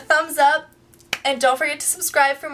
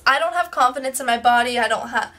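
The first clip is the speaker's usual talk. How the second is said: ordinary talk, nothing marked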